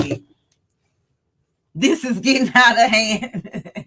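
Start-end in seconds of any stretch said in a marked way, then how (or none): 1.7-3.9 words breaking into real laughter; can't hold the laugh in, let it spill over; loud burst of real laughter; laughing helplessly. Real, not acted. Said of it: "I cannot stop laughing".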